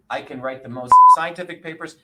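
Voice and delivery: loud, proud boast on scientific papers. Real, not acted. Boasting to the host about his writing, loud. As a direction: belt out the boast.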